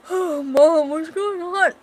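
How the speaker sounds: put-on high, loud child's voice; young boy. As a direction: act out a startled boy calling to his mom. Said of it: "this is baby Callum".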